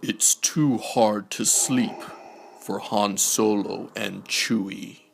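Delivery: usual speaking voice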